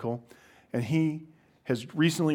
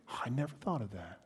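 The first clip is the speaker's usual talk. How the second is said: quiet, quick sarcastic aside; much softer, dry sarcasm; mock realization; 11 dB quieter, faster than usual. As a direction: feign innocent surprise under the breath. Mock reply to obvious healing question.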